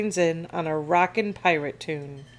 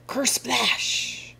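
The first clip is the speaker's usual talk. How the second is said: higher, drawn-out splash; voiced splash effect; 6 semitones higher than usual. A narrator's reading. Storyteller as another arm hits water, splash effect. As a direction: act out a wave crashing with the words.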